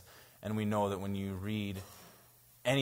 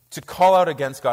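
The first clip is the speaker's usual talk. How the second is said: louder, rising preacher's punch; emphatic; 5 semitones higher, 12 dB louder than usual. Real, not acted. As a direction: drive the line home hard.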